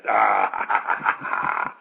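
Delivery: loud imitated mocking laugh; big mock cackle, imitating a heckler; Frank Zane. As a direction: burst out in pulsing, shouted guffaws. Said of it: "Frank would be laughing on the side".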